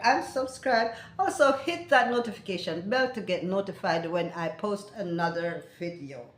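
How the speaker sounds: usual speaking voice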